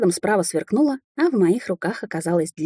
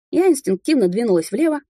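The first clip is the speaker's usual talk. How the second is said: usual speaking voice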